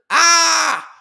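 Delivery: sudden loud, high scream; mock scream. Streamer checking for viewers in the chat, mock scream. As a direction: let out a high mock shriek.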